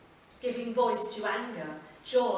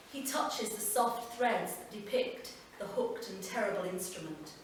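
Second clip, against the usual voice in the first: ordinary delivery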